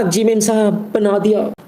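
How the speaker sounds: meek maid. Acted. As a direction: answer like a dutiful servant. Voicing maid's reply.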